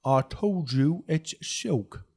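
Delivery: high, very slow drawl; patient correction. Acted. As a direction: correct them with drawn-out patience. Boss corrects his name, before anger.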